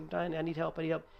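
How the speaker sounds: high, repeated mock plea; high-pitched urgency, volume held back; retold frantic panic. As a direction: imitate your brother begging for backup. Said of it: "it was really frantic".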